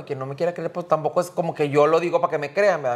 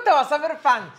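high mimicking sneer, much higher; mocking imitation; 8 semitones higher than usual. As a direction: parrot him back in a mock high voice. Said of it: "no digo". (burla imitando, mucho más agudo)

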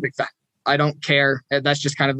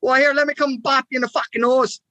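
high-pitched caricature of a Canadian heckler; Canadian impression; 9 semitones higher than usual. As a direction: tease like a chirpy Canadian, pitched way up. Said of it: "you get the Canadian lifting a two for four".

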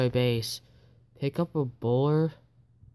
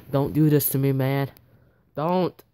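louder, desperate plea; pleading cry; 6 dB louder than usual. Real, not acted. Gamer begging his attacker not to fail, loud and desperate. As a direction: beg the screen not to fail.

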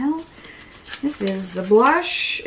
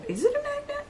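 squeaky voice; 6 dB quieter, faster than usual. Acted. Puzzled squeaky question.

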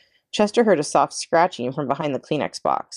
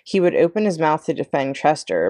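ordinary delivery, nothing marked

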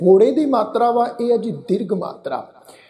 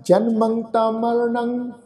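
slow recitation; much slower than usual. Shown as a demonstration. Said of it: "ਆਓ ਇਸ ਦਾ ਰਲ ਮਿਲ ਕੇ ਉਚਾਰਨ ਕਰਦੇ".

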